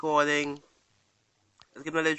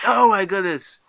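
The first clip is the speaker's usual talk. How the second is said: loud, a touch higher burst; excited exclaim; 3 semitones higher, 9 dB louder than usual. Real, not acted. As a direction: shout the surprise at the game. Last chance run reaction.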